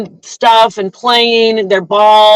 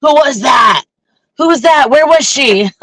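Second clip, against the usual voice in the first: mock eager stalkers; 4 semitones higher than usual. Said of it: "I don't want the stalkers like".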